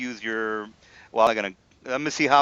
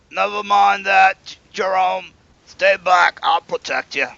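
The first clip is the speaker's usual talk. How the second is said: a touch higher, louder protective leader; guard leader; 5 semitones higher, 5 dB louder than usual. Acted. Guard leader tells servant Jerome to stay back. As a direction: order him back, standing guard. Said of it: "And this is".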